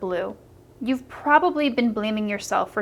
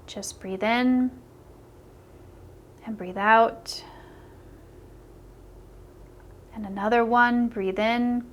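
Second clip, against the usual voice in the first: guided meditation. Acted. Coach leading a listener through a self-forgiveness exercise, guided meditation. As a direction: guide the listener calmly, leaving room to breathe.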